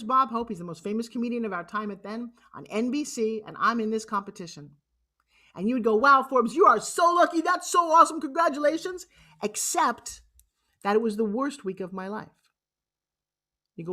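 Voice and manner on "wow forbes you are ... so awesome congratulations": high, loud, excited congratulations; gushing. Retold or imitated. Imagined listener congratulating Forbes on the TV contest, gushing. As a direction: gush loudly like an impressed friend.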